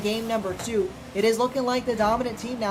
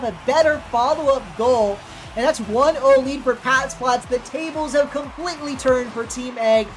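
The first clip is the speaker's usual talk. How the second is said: usual speaking voice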